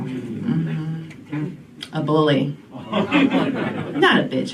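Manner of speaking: ordinary talk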